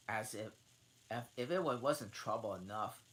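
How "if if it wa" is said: usual speaking voice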